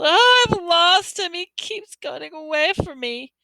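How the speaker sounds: high, slow wail of loss; boy wailing over his lost toad. Acted. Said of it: "he wailed".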